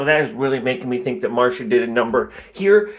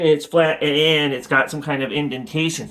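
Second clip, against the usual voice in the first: normal talking voice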